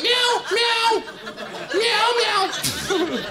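very high, loud mock meowing; cat meows. Comedian playing his frightened, well-behaved cat, shrill high meows. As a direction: yowl like a squeaky cat. Imitates the cat going to the toilet.

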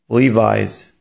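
"Levi's" said LEE-vize; correct Levi's. Shown as a demonstration. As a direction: say "Levi's" with an EYE sound and final Z. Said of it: "Prawidłowa wymowa tego słowa brzmi". (poprawna wymowa: "Levi's" jak „liwajz”)

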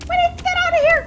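very high, loud panicked shriek. Real, not acted. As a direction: scream it in rising panic. Panicking in a dangerous cave.

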